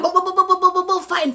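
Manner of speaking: high, loud, rapid booms; imitated punching. Storyteller showing her fighting back, imitated punches. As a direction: fire off punch noises in a burst.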